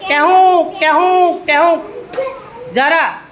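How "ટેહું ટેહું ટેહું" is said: drawn-out two-note peacock cry; peacock call. Shown as a demonstration. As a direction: imitate a peacock calling. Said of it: "મોરનો અવાજ કાઢ". (મોરનો ટહુકો)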